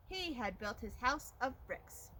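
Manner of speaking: plain reading voice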